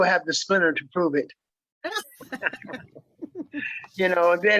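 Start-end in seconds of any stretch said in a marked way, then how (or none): 1.8-2.8 quiet, high chuckle; soft chuckle. Real, not acted. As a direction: let out a soft little laugh. Guest jokes about the splinter.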